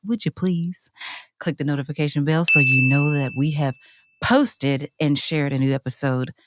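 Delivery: podcast intro voice. Real, not acted. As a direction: ease the listeners in softly, stretching the key words. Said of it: "Why are you talking like that?".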